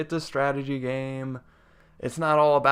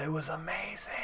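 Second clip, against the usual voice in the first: awed whisper; 3 semitones higher, 14 dB quieter than usual. Real, not acted. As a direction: breathe it in hushed awe. Raving about psychic soldier.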